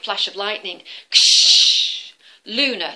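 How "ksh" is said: very loud, high crashing noise; thunder crash. Shown as a demonstration. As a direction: make a thunderclap. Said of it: "crash of thunder".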